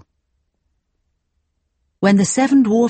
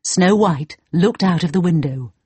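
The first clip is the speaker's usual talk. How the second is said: usual speaking voice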